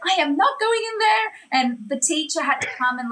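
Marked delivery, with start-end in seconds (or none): 0.1-1.3 high, whiny refusal; stubborn kid digging in; stubborn kid protest. Retold or imitated. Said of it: "And I was like".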